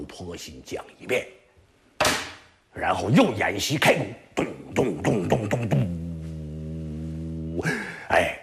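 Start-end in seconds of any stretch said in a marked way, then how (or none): 4.3-7.6 low, slow mimicked drumbeats; drum imitation. Imitating drums. (低沉缓慢地模仿鼓声)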